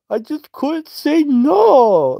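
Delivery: put-on very high, slow baby voice; mocking childish voice; babyish whine. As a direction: whine it like a toddler. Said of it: "He tells Debbie".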